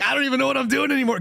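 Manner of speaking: high, fast, frantic newscaster voice; panicked anchor. Retold or imitated. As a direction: play a flustered anchor losing it. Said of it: "He's like".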